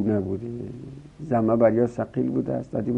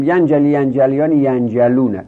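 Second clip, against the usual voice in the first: higher, louder recitation; یرتجی، یرتجیان، یرتجونه called out high and loud; reciting forms; 4 semitones higher, 7 dB louder than usual. Shown as a demonstration. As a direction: announce the forms to the class. Teacher reciting the paradigm.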